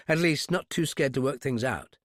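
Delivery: ordinary delivery, nothing marked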